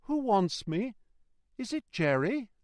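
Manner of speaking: high, timid questioning voice; mildly quavering. A narrator's reading. Passenger calling into the mist, high mild quaver. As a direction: ask nervously in a high voice. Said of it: "with mildly quavering speech".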